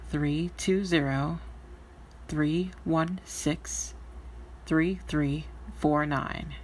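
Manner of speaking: slow, spaced-out digits with pauses between groups; deliberate, dictation-pace phone number; slow repeat. Shown as a demonstration. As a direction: read the number slowly for someone writing it down. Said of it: "I'll repeat it slowly this time".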